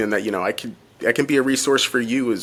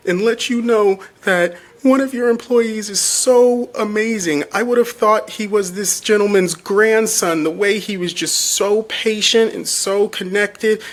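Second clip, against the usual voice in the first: imitating a weepy caller, much higher and slow; tearful nurse; 10 semitones higher, slower than usual. Zach retelling the nurse praising his caregiver, high, slow, tearful. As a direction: draw it out like someone moved to tears. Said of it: "and she's in tears".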